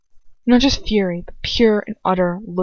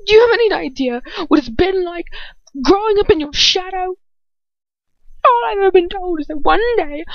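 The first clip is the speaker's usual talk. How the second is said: much higher and louder, anguished outburst; bursting out; 12 semitones higher, 5 dB louder than usual. A narrator's reading. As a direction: unload years of resentment at once. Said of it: "bursts out".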